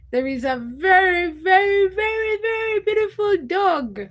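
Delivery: gushing excitement. Real, not acted. Gushing over a beautiful dog.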